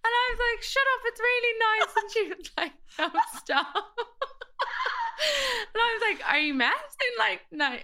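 high, loud laughing retelling; can barely get the words out for laughing; laughing through the story. Real, not acted. Telling her friend about the facial, laughing hard.

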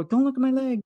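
urgent cry. Retold or imitated. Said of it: "So I go".

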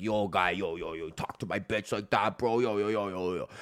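lightly voiced drunk bro; mock angry dude. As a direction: imitate a sulky boyfriend. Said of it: "this dude instantly starts shooting me daggers".

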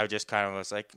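ordinary talk, nothing marked

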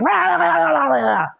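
high-pitched imitation of a shouting match; mock yelling. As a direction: gabble like two people yelling at once. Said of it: "he's yelling at me and I'm yelling at him".

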